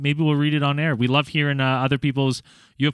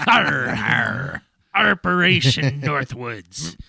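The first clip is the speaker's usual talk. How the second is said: slow pirate voice; Steve the Pirate voice; much slower than usual. Acted. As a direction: say it like a pirate captain. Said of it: "doing the fucking story in your Steve the Pirate voice".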